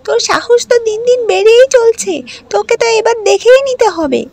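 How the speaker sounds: high, loud, sneering stepsister voice; spiteful stepsister. Acted. Stepsister scolding Ragini over the dress, high and loud. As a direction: jeer at her, shrill and loud.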